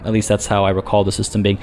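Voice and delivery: normal talking voice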